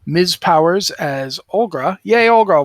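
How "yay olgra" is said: excited cheer. Real, not acted. Cheering a favourite character.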